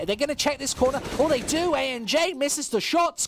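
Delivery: surprised rise on the play; excited call. Real, not acted. Caster reacting to a corner check, excited call. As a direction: pitch jumps up as the fight breaks. Clutch moment in casted round.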